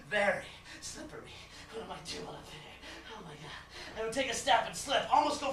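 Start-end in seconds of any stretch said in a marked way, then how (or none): 1.7-3.6 very soft, low self-talk; hushed, low dreamer's aside; alarmed muttering. Acted. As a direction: mutter it under your breath. Dreamer frightened on the slippery girders.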